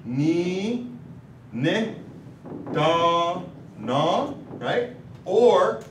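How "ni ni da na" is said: "Ni, ni, da, na" with a pause between each syllable. Shown as a demonstration. Teacher sounding out each syllable for students, separated. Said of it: "So I could say it like this".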